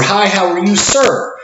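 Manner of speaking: bright cashier greeting. Shown as a demonstration. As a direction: greet the gentleman like a cheerful cashier. Cashier greeting, sir version.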